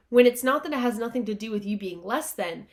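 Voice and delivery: ordinary delivery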